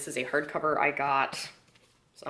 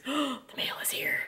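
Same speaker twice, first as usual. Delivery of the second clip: excited whisper; 9 semitones higher, 4 dB quieter than usual. Real, not acted. Gasping at mail arriving.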